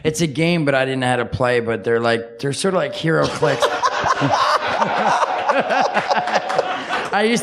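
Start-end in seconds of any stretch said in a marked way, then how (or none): 3.6-7.1 a long airy laugh, almost silent; breathy laugh. Real, not acted. Laughing at himself over the old hobby. Laughing at his own story.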